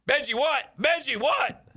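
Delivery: high, urgent repeated question; urgent calling. Retold or imitated. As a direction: call his name into the phone, worried. Urgent call into phone.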